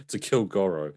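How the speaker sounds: normal talking voice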